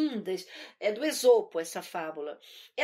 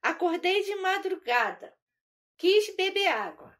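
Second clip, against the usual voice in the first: put-on higher childish chant in steady metre; little girl singsong; 5 semitones higher, 3 dB louder than usual. Little girl tells mother about the locked kitchen, high singsong. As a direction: recite it like a small child's rhyme. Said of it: "é, fiz assim".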